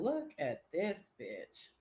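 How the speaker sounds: very soft, low and slow; hushed aside. Retold or imitated. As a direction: barely voice it, stretching the words. Repeats the aside more softly.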